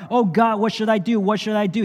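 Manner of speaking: high, loud desperate cry to God; anguished cry. Acted. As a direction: cry out in despair, lost and guilty. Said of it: "he cried".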